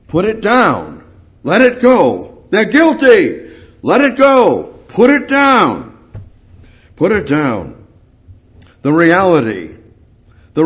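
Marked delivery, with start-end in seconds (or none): 0.1-4.7 building from firm to high, loud pleading; rising impassioned preacher's cry; preaching at full force. Acted. 4.9-6.0 "Put it down" high and loud, slowed and pressed; preacher crying out "Put it down"; impassioned plea. Acted. Repeated plea, peak then calm.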